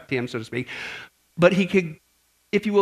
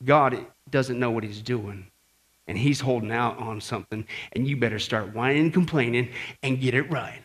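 Satan's persuasion; 7 semitones lower, 4 dB quieter than usual. Acted. Satan talking us into complaining, low quiet persuasion. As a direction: coax quietly, low and deliberate. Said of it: "he's trying to get us to say".

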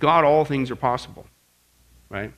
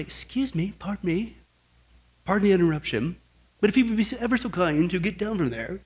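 mock politeness; 2 semitones higher than usual. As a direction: timidly beg pardon, hammed-up courtesy. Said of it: "you don't say".

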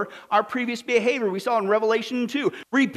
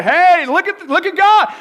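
hammed-up loud call, high and much louder; mock shout, high and much louder; 8 semitones higher, 11 dB louder, slower than usual. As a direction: yell it like a pushy street preacher. Christians shouting at people.

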